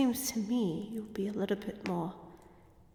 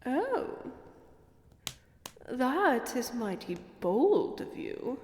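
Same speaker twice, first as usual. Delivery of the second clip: ordinary delivery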